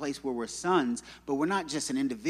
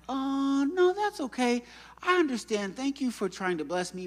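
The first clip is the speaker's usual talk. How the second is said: very high, over-polite brush-off; mock polite refusal; 9 semitones higher than usual. Speaker turning down pastor's help, exaggeratedly polite and high. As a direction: wave the help away in a high, placating voice.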